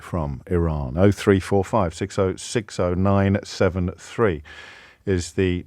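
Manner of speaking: slow, low, measured on-air read; radio presenter link. Real, not acted. Host inviting listeners to phone in, measured presenter link.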